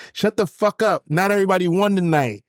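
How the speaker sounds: high nasal snap; nasally voice. Retold or imitated. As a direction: sneer it in a nasal voice. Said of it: "like, this nasally voice like".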